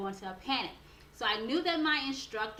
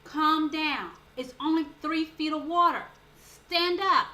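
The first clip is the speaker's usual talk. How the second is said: louder, insistent instructor telling her to stand; firm command; 4 semitones higher, 6 dB louder, slower than usual. Retold or imitated. Instructor ordering a panicking student to stand up, louder. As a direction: snap her out of it, firmly. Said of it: "and she says".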